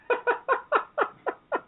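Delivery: a high burst of real laughter. Real, not acted. Laughs at her own retold story.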